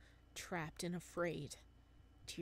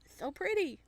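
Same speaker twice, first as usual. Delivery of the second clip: gushing; 11 semitones higher, 8 dB louder than usual. Real, not acted. Gushing over the art.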